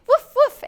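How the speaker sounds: high, loud double bark; dog's woofing. Acted. Dog answering the little bird, high loud woofing. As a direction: bark back like a storybook dog. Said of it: "answered the dog".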